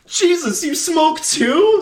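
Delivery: very high, slow, aghast gasp; shocked Marty. Retold or imitated. Marty stunned to see his mom smoking, very high and slow. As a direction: stretch it out in disbelief. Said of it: "She's like".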